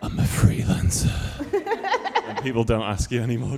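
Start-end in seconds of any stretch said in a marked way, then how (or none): 0.0-2.3 slow, high, drawn-out smug boast; smug yuppie drawl. Shown as a demonstration. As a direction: stretch out "freelancer" smugly, laughing. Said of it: "No, but if you say it like this".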